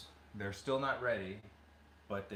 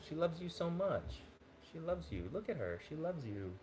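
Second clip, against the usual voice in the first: higher, softer pet talk; cooing to the dog; 9 semitones higher, 5 dB quieter than usual. Real, not acted. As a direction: coo it tenderly to the pup.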